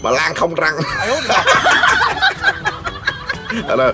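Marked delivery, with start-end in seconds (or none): 0.8-3.6 long high-pitched laughing fit; laughter. Real, not acted. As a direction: crack up at your own joke. Cười câu đùa rụng răng. (cười ngặt nghẽo)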